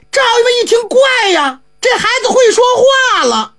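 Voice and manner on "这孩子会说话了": higher, laughing with joy; delighted laugh. A narrator's reading. Squire laughing as his mute son speaks, high. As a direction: burst out laughing in relief.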